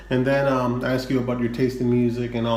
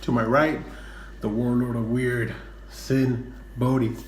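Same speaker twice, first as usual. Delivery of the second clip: podcast announcer. Acted. Podcast intro over music.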